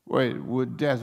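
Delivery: ordinary talk, nothing marked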